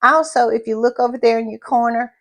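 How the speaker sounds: normal talking voice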